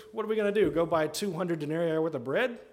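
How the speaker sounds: sarcastic. Acted. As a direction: throw it back as an incredulous, mocking question. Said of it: "people that are too sarcastic".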